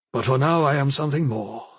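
usual speaking voice

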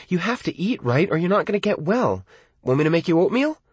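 worried mom coaxing her son; mother's voice. A narrator's reading. Narrator voices his mother.

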